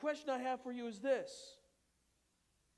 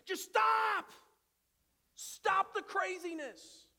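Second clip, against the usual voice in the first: loud exasperated shout; a dad snapping at fighting kids; fed-up dad; 8 semitones higher, 5 dB louder, slower than usual. Retold or imitated. Tired dad woken by fighting kids, loud shout. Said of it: "When your kids start fighting".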